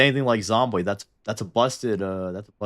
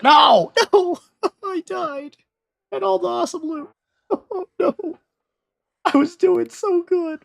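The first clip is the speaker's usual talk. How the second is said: mock wailing despair; 7 dB louder, slower than usual. Character dies, loses loot.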